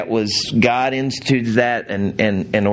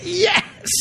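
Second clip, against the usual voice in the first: very high-pitched, squealed mock triumph; gleeful cheer. Preacher voicing Pharisees who waited for that, mock gleeful cheer. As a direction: imitate a secretly delighted onlooker cheering.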